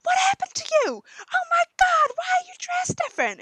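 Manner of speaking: high, loud squealing friends; posh friends shrieking at the swapped girl; shrill posh girls. Retold or imitated. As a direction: squeal like a gaggle of shocked schoolgirls. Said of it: "their friends are like".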